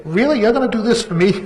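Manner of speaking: high, loud mock disbelief; snide. Mocking the publisher he thinks is his friend's wife. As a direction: sneer it out in fake amazement. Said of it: "I'm really being kinda snide".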